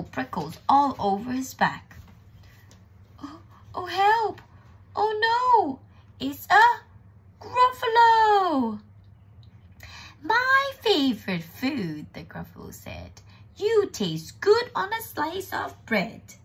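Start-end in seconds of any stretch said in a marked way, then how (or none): 3.2-8.8 small oh building to a high shout; terrified cry. Acted. Mouse terrified by monster. 10.2-12.1 high, loud, slow relish; hungry monster. Acted. Gruffalo threatens to eat mouse. 13.6-16.3 high, loud, slow gloating; hungry monster. Acted. Gruffalo threatens to eat mouse.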